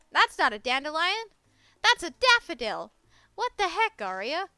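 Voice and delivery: loud, comic outrage; loud, scandalized protest; indignant outburst. Real, not acted. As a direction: shout the correction in playful disbelief. Outraged at misnamed flower in game.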